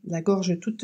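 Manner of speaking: talking between sips; words around a sip of water; while sipping a drink. Real, not acted. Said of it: "Pardon, je bois".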